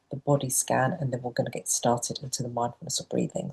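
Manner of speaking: ordinary delivery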